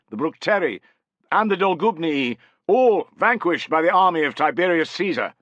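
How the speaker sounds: a general reading aloud, high and loud, to the army; formal proclamation. Acted. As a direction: declaim each tribe's name to the crowd. Reads the victory proclamation.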